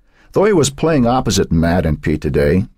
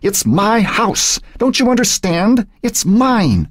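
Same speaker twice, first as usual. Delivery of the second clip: high, drawn-out protest; indignant demand; slower than usual. A narrator's reading. Old woman to the fireman holding her back, indignant. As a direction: insist in a high, outraged voice. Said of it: "Emily Moore demanded".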